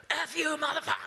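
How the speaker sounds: high, spat-out retold curse; cursing yell. Stranger at the gate cursing the homeowner, yelling. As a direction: hurl the curse like an out-of-control man. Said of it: "how violently out of control".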